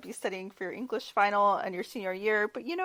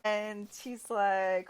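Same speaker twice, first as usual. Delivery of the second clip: ordinary talk, nothing marked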